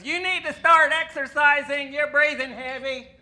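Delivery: loud, high, slow mock nag from the girlfriend; nagging girlfriend. Girlfriend in bed telling him to exercise, loud slow nag. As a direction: ham up the scolding partner.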